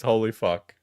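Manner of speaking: ordinary delivery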